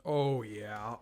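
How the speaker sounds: usual speaking voice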